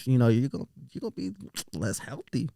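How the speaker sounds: soft, halting uncle imitation; Uncle Chris. Uncle relaying doctor's advice, softly mimicked with halting pauses. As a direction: mimic an uncle trailing through his explanation, softly. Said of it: "that's how he talks".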